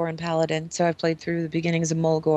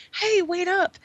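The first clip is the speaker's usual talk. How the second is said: much higher call from behind; buddy calling; 10 semitones higher, slower than usual. Retold or imitated. Little buddy chasing the player, higher call. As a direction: call out to catch up. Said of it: "you hear this".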